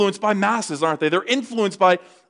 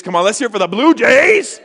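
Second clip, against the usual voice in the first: rally the crowd, rising to a shout; loud, climbing sports-fan cheer; rousing cheer call; 3 semitones higher, 5 dB louder than usual. Real, not acted. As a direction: whip them up to cheer.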